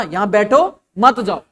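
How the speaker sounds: slow, split after बैठो before मत जाओ; pause after बैठो. Shown as a demonstration. Teacher repeating the sit-down meaning for students, slow with a pause after बैठो. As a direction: slowly say यहाँ बैठो, break, then मत जाओ. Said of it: "अब इसका मैं अगर पॉज लूं".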